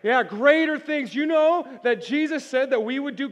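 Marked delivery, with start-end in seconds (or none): 0.0-1.1 greater punched loud and higher, slow; slow and loud on "greater things"; stress on greater. Real, not acted. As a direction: lean hard on "greater". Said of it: "Everybody say greater".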